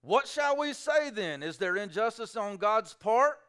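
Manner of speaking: high, slow, incredulous questioner; objector's question. Retold or imitated. Preacher voicing the objector's protest. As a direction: voice the doubting audience asking. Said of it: "they say".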